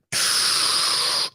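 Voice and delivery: long hissing inhale, imitated; sharp intake of breath. Host mimicking the caller straining for oxygen, exaggerated gasp. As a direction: suck in a huge noisy breath. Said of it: "she was working like".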